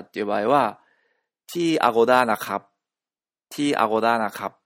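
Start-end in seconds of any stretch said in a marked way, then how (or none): 1.5-4.6 louder, carefully spaced Thai example; Thai phrase demo. Shown as a demonstration. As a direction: say T、アゴダナカ loudly as a model Thai phrase. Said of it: "というふうに言ってあげると". (タイ語のお手本を大きめにはっきり)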